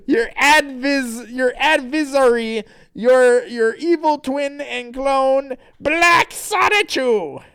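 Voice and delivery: high, loud, drawn-out villain reveal; Nate. Acted. Villain unveils the evil twin, slow and grandiose. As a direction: build to a grand theatrical unveiling. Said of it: "Nate person says".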